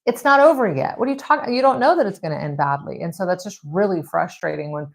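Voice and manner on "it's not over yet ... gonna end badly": it's not over yet, exasperated. Real, not acted. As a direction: push back on doom talk. Rebutting the doubters, exasperated.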